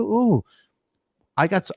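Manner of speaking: ordinary delivery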